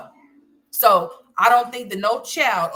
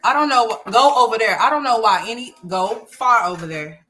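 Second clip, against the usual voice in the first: shooing someone away; 3 semitones higher, 7 dB louder than usual. Real, not acted. Mom loudly telling the kid to go over there, firm shout. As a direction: raise the voice to shoo him off.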